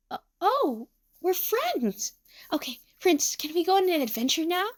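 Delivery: high, small toy voice; timid wonder turning eager; little plushie asking hopefully; shy bunny plushie, delighted. Acted.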